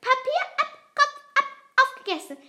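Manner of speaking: high, piping chant; high-pitched gruesome glee, dropping at the end; crowing. A narrator's reading. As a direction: rattle it off like a crowing little creature. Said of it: "krähte Fliegenbart".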